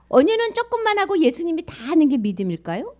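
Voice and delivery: ordinary delivery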